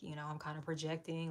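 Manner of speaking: normal talking voice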